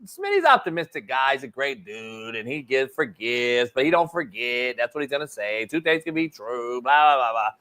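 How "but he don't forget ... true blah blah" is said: normal talking voice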